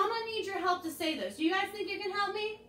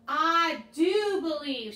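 slow call-and-response as Thomas; 5 dB louder, much slower than usual. Shown as a demonstration. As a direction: DO leaned on. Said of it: "This time you're gonna say".

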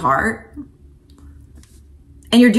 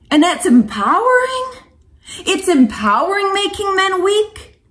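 sarcastic disbelief; 6 semitones higher than usual. Real, not acted. Incredulous rhetorical question.

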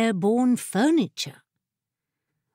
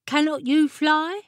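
raised pitch, rising question; questioning Jessamine; 7 semitones higher than usual. A narrator's reading. Jessamine challenging the chair, questioning. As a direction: put the question higher, lifting at the end.

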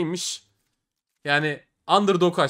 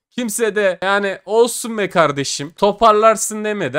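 angry shout; 6 semitones higher, 8 dB louder than usual. Real, not acted. Angry at mocking viewers.

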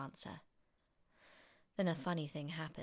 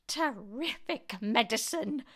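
higher, louder, drawn-out relish; excited cry; 6 semitones higher, 5 dB louder, slower than usual. A narrator's reading. Grandma praises George's medicine, excited drawn-out cry. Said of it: "she cried".

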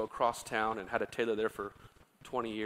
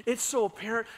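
ordinary delivery

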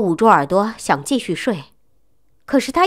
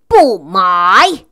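loud curt refusal; shouted no; 7 dB louder than usual. Acted. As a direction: bark the refusal at the salesman. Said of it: "我大声吼道". (大声吼道)

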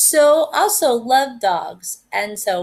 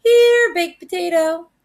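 high call to a pet across the yard; 8 semitones higher, 5 dB louder than usual. A narrator's reading. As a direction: call the dog in from afar. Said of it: "she called for him".

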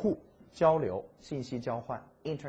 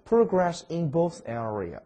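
slow read-aloud; 5 semitones higher, 6 dB louder, slower than usual. Shown as a demonstration. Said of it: "teacher reads English slowly".

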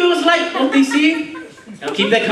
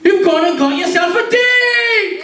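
excited shout; 6 semitones higher, slower than usual. Real, not acted. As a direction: announce it like a gameshow host. Host announces a date.

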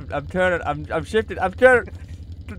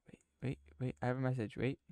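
urgent breathy whisper; 6 semitones lower, 15 dB quieter than usual. Acted. Gamer hushing his friend, whispering urgently. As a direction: hush him with a secret.

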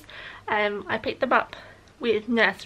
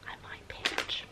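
sneaky whispered joke; conspiratorial whisper; 14 dB quieter than usual. Pretend. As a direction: whisper it like a guilty secret. Joking about eating child's eggs.

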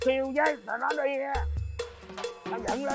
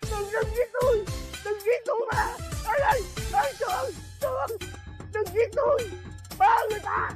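terrified pleading; 7 semitones higher than usual. Acted. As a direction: plead in terror not to be killed. Begging a ghost not to kill.

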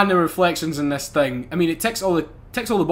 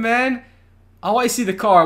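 normal talking voice

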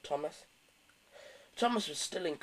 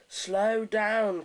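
higher, urgent call to slow; 6 semitones higher, 4 dB louder than usual. A narrator's reading. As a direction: call out a warning as the driver. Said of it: "called Thomas's driver".

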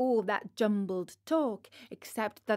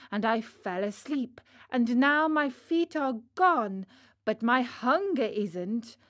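plain reading voice

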